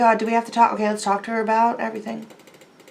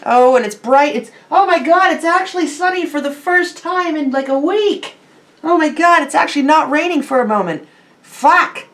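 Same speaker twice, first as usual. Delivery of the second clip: excited; 6 semitones higher, 4 dB louder than usual. Real, not acted. Delighted by sudden sunshine.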